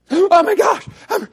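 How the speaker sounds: hammed-up alarmed cry; mock panic. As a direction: squeal it like someone freaking out over bad news. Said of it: "you freaking out about it".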